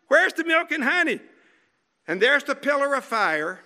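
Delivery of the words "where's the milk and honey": high, fast mock complaint; whining Israelite. Preacher mocking the Israelites' complaint, high and whiny. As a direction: mimic a whiny grumbler.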